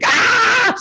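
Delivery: sudden loud, very high panicked yelp; a startled shriek of alarm; inner scream. Retold or imitated. Surgeon's hidden inner panic during a complication, loud scream. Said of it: "And in the inside, you're going".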